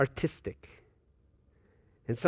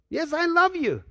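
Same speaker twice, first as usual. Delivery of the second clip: insistent answer; 12 semitones higher, 4 dB louder than usual. Acted. Believer insists to the Lord.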